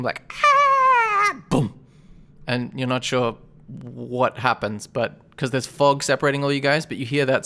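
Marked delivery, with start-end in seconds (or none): 0.3-1.4 very high, loud curdling scream; gurgled bloody scream. Acted. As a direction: let out a gurgling death shriek. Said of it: "gurgled, like, bloody, curdling scream".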